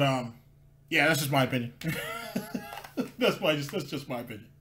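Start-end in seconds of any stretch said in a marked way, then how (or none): 1.8-3.1 high, soft wheezy laugh; amused chuckle. Real, not acted. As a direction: laugh quietly at your own remark. Amused by his own opinion.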